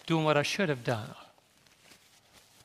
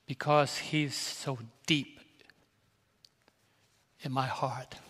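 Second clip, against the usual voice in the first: choked up; 4 dB quieter than usual. Real, not acted. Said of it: "excuse me for being a little, uh, crybaby".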